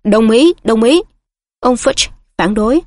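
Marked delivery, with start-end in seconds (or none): 0.0-1.0 a slightly louder squeaky agreement; mild squeaky agreeing; squeak. A narrator's reading. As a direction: pipe up eagerly. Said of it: "rít lên".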